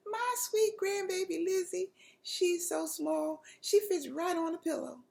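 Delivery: put-on high grandma voice, cooing over the baby; doting grandmother. A narrator's reading. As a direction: gush about the tiny grandbaby. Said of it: "hear Grandmommy talk about the day".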